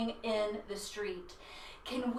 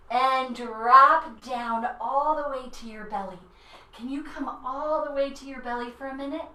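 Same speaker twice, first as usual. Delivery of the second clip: ordinary delivery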